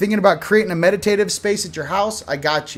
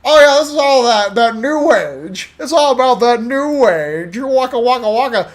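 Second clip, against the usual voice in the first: mocking new age; 6 semitones higher, 5 dB louder than usual. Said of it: "You're like".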